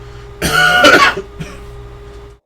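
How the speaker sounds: harsh cough from a strained throat. Real, not acted. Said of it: "Warrior's voice hurts my throat".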